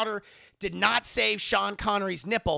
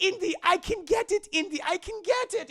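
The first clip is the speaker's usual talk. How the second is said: much higher, straining woman's voice; pitched-up greedy plea; high Elsa voice; 10 semitones higher than usual. Retold or imitated. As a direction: reach for the cup, straining. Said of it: "She's like".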